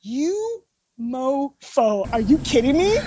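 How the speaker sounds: very slow, hushed and stunned; mock disbelief. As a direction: drag the words out under your breath, incredulous. Retelling stunned reaction to the news.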